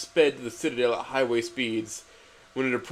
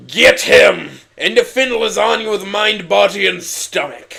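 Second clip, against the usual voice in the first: much louder, higher command; rally henchmen at full volume; hammed-up villain shout; villain barking orders; 9 semitones higher, 12 dB louder, slower than usual.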